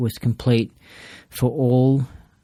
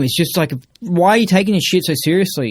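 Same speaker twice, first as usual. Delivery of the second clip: ordinary talk, nothing marked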